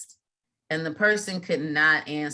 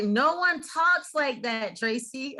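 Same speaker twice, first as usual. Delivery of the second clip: a lightly voiced, higher, slow dismissive niece; imitating the niece; 8 semitones higher, slower than usual. Niece correcting her aunt's texting, mild mock scorn. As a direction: deliver it like an unimpressed teen setting her straight. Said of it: "She's like".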